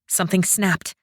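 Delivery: ordinary delivery, nothing marked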